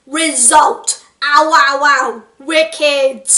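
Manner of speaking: loud cheeky London lad; chav whooping. A narrator's reading. Second spirit celebrating, loud chav whoop. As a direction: whoop like a lad who just won.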